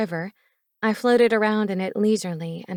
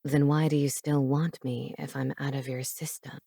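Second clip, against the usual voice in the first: low, soft teasing man's voice; low seductive man; 5 semitones lower, 4 dB quieter than usual. Acted. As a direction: purr it low and close.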